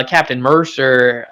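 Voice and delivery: normal talking voice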